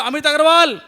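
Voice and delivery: high, loud shout of the name; calling out loud. Retold or imitated. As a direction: call your own name into the water. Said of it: "उन्होंने बोला". (ऊँची पुकार में नाम)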